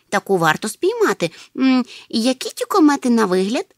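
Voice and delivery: loud, eager curiosity; delighted. A narrator's reading. Hemul keen to catch a comet, delighted. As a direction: ask eagerly, voice lifting. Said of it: "зрадів Гемуль".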